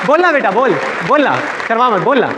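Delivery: put-on high, insistent urging of a shy child; coaxing relative. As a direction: imitate a relative prodding a kid to speak.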